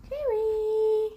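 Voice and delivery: high, bright call of a pet's name. Real, not acted. Owner calling to her pet Jerry, high and bright.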